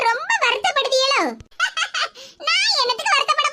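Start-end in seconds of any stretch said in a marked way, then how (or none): 1.6-2.1 short mocking laugh at her daughter-in-law; a little smug laugh, breathy and pleased; gloating chuckle. Mother-in-law gloats over her daughter-in-law's injury, smug chuckle.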